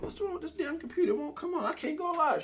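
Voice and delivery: high-pitched mock fluster at a computer; frustrated complaint. Acts out his broken-computer complaint.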